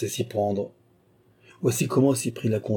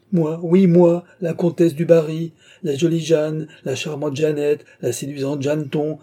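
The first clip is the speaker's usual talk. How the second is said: loud, high, indignant self-naming; indignant outburst; 5 semitones higher, 5 dB louder than usual. Acted. Countess throws her pet names back at the king, indignant. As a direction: list each title with rising affront, easing slightly.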